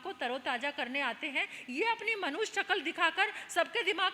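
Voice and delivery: mildly indignant, rising in pitch and force; scornful complaint. Acted. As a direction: voice a prince disgusted at shabby monks. Prince scorns monks in story. (तिरस्कार भरी शिकायत)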